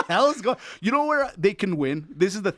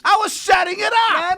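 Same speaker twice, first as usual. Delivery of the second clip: shouted high and loud, protesting; insistent shout; 13 semitones higher, 7 dB louder than usual. Real, not acted. Host protesting to teasing co-host, loud insistent shout. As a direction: yell it over the teasing to defend yourself.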